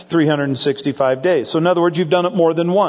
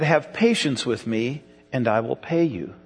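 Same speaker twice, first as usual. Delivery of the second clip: soft, quiet retold plea for more time; pleading quietly; 6 semitones lower, 6 dB quieter than usual. Debtor begs the pastor for patience, quietly pleading. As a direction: beg softly like a debtor who cannot pay. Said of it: "He said".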